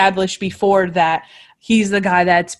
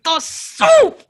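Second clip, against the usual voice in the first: much higher, squawked "The soup!"; crazy man voice. Retold or imitated. Imitating Monk insisting on the soup in his crazy voice.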